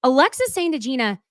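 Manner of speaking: normal talking voice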